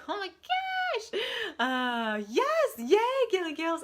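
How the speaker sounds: very high, loud joyful shout; excited shout. Real, not acted. Host shrieking as Gilly joins the stream. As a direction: shriek with happy surprise.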